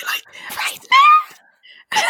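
slow, squeaky whispered moan; high-pitched whisper. Shown as a demonstration. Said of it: "so high-pitched whisper".

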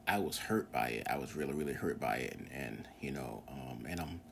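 low, soft and choked up; fighting back tears. Real, not acted. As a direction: say it while holding back tears. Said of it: "getting a little emotional right now".

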